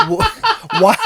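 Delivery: high squealing laugh through the words; cracking up mid-word; helpless laughter breaking the question; laughing hard. Real, not acted. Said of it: "Why is the fame?".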